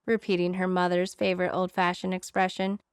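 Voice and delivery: plain reading voice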